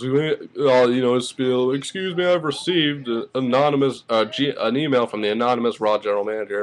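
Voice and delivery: a hint of a mocking commentator; Michael Cole. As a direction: lightly parody an official announcement. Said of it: "and Cole says".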